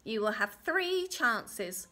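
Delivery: slow, loud pronouncement; little man's terms. Acted. Little man sets the mother a test, emphatic. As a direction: stretch and punch the number.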